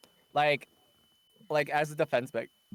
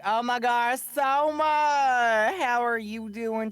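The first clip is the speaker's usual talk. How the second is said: much higher, louder, slow gushing deep South relative; deep South accent; 10 semitones higher, 8 dB louder, much slower than usual. Retold or imitated. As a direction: gush like a deep South aunt. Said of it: "sometimes that accent will come out".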